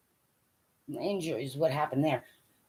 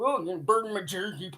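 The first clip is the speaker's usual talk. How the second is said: mock whiner; 5 semitones lower, 7 dB quieter, faster than usual. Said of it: "instead of".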